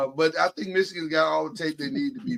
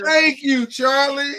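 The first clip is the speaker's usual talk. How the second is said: higher, louder, triumphant thanks; delighted thanks; 7 semitones higher, 5 dB louder, slower than usual. Real, not acted. Host thanks Charlie for the Texas Rangers shout-out, delighted. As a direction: crow your thanks to him. Grateful for teammate's shout-out, teasing others.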